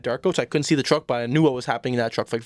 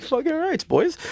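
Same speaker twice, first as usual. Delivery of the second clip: high-pitched, gung-ho cheer to the lads; hyped-up agreement; slower than usual. Acted. Telling the boys he's in on the plan, hyped up. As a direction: crow it high, all in on the plan. Acting out his eager younger self.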